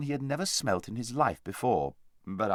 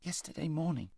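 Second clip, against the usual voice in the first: faint, much softer, tired from hunger; weakly; 6 semitones higher, 8 dB quieter than usual. Acted. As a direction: barely get it out, faint with hunger. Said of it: "said Shasta weakly".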